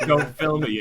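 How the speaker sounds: mocking the friend. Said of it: "quoting the friend".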